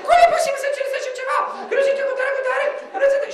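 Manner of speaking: high, loud, fast hysterical squawk; hysterical shrieking woman. Retold or imitated. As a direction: cackle it out like a panicked hen on the phone. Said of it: "Și aia țipa, uh, cotcodăcea, isterica la telefon".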